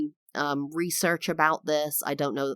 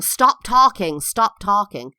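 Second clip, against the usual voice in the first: retold teacher's sharp shout, higher and louder; 4 semitones higher, 8 dB louder than usual. Teacher yelling at a chattering pupil, imitated shout. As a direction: bark it like a fed-up teacher. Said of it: "the teacher was shouting at me".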